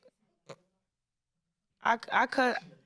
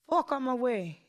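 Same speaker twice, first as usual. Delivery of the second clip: higher-pitched imitation of a New York accent; 4 semitones higher than usual. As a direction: do a brusque Times Square New Yorker. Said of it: "what accent was that, was that? ... That's a New York accent".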